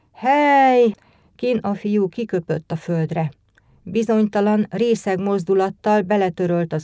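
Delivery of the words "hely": loud, high drawn-out holler; one raised, swelling shout; calling out. A narrator's reading. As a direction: holler it like a drunk. Drunk boy shouts out in story.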